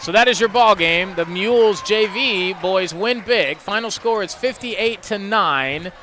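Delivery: upbeat radio sign-off to the break; radio announcer. Acted. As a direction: wrap up the game for listeners. Radio host wraps up the game.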